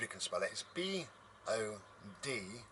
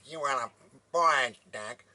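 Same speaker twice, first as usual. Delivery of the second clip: loud, higher, badly imitated farmer; silly farmer voice; 5 semitones higher, 12 dB louder than usual. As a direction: overdo a farmer impression until it's absurd. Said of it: "he didn't speak like that either".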